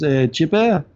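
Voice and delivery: put-on boss's French-accented call. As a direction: imitate a Frenchman getting someone's attention. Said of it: "he's French and he's got this accent".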